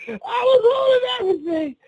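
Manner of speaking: high, squealing through real laughter; can barely get it out for laughing; laughing hard. Real, not acted. Cracking up at his own story.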